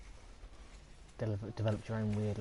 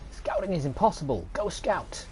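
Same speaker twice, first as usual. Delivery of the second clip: higher, louder mock command; mock game order; 8 semitones higher, 6 dB louder than usual. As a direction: parody a pompous order. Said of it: "It's like".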